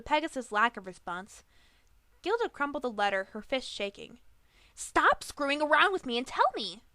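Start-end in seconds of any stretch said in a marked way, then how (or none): 4.7-6.8 higher, much louder yell; loud angry demand. A narrator's reading. Griffin demands the truth, yelling. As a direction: shout her down. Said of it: "flinched to the volume of Gilda's voice".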